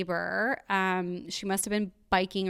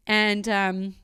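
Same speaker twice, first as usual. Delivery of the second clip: ordinary talk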